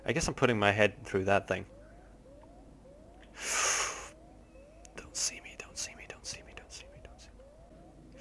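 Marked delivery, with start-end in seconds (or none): 5.0-8.2 barely audible, repeated pleading whisper. Real, not acted. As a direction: whisper it like a frantic prayer to stay hidden. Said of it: "I don't know why I'm whispering again".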